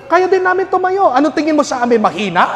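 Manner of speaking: high, loud, furious woman's rant; angry feminist woman. Retold or imitated. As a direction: yell at him as the outraged feminist. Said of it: "sinigawan ako". (galit na sigaw ng babaeng feminista)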